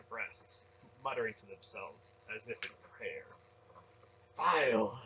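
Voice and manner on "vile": loud, high cry of disgust; stern. A narrator's reading. Zealot condemning the temple's sacrifice, loud stern outburst. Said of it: "Relm said sternly".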